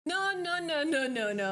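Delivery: mock refusal. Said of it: "I'm like".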